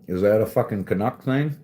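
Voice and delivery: usual speaking voice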